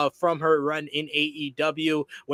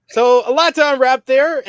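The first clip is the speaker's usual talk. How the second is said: exasperated burst; 9 semitones higher, 10 dB louder than usual. Real, not acted. Host exhales after long quote.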